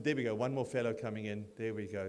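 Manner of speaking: ordinary talk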